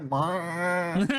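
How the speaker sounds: bleated "meh"; imitated dancer noise. Teller imitating lap dancers' noise, silly bleat. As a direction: make a silly drawn-out bleat. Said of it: "That's the noise they make".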